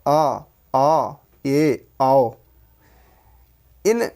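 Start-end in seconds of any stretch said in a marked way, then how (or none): 0.1-2.3 अ, ऑ, ऐ, औ with mouth half open; half-open vowels. Shown as a demonstration. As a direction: sound each vowel separately, lower, half-open. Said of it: "मुख का आधा खुलना".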